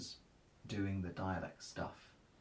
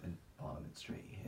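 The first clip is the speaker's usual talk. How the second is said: whispering; 7 dB quieter than usual. Real, not acted. Joking aside about dialect today.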